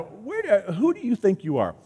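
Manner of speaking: a mock-offended big brother snapping back; indignant big brother. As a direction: put the kid in his place. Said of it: "I go".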